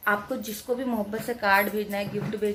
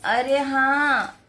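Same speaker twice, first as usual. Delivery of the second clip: loud, higher, drawn-out answer; stretched, raised-voice exasperation; exasperated reply; 4 semitones higher, 7 dB louder, much slower than usual. Real, not acted. Mother answers child's repeated interruption.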